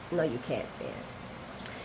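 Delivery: quietly breaking off at the name; fading to a breath. Retold or imitated. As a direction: let it die away on Finn. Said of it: "And Tracy reminds him".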